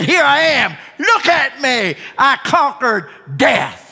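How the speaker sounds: high, loud victory cry; triumphant proclamation. Acted. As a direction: proclaim it in triumph, drawing out the last words. Risen Jesus announces himself to disciples.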